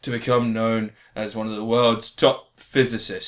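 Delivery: slow, theatrical storyteller delivery; mock-grand introduction. Host unveils the film reviewed this week, mock-grand introduction. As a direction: announce it with pomp, pausing for weight.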